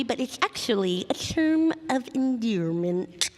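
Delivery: speaking with a slushy lateral lisp. Shown as a demonstration. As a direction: let the s sounds slush sideways. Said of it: "uh, lateral lisp".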